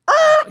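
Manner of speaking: high loud brake screech. Retold or imitated. Mom slamming the brakes on the hill, high screech. Imitating sudden braking.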